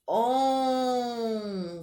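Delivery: "onça" with a long, sustained "on"; stretched syllable. Shown as a demonstration. Teacher giving children an example, "onça" drawn out. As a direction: hold the "on" of "onça", then release "ça". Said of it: "compridas, como por exemplo".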